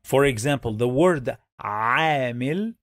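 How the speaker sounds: normal talking voice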